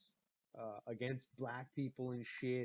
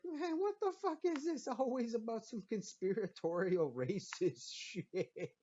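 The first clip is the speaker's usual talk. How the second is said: incredulous outburst; 8 semitones higher than usual. Retold or imitated. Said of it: "I'm like".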